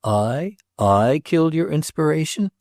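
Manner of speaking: mildly outraged, affronted disbelief; righteous wrath. A narrator's reading. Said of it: "cried Mister Leavenworth, with the accent of righteous wrath".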